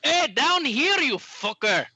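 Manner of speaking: loud, high-pitched halfling yell; indignant little-guy holler; calling up to someone. Acted. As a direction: shout up for attention from down below. Halfling calls up to tall orc.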